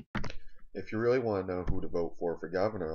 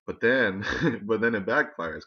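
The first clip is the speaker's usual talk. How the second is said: normal talking voice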